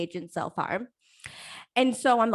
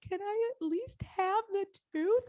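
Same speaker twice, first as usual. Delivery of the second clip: meek plea; 9 semitones higher, 5 dB quieter than usual. Retold or imitated. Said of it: "I was like".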